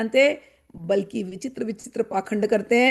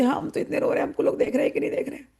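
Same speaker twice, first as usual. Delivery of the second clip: fast, higher, half-whispered aside; mock fake devotee; 4 semitones higher, faster than usual. Fake devotee checking if the crowd watches, hushed and quick. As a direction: sneak a look while faking tears. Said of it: "दूसरे को देखेंगे".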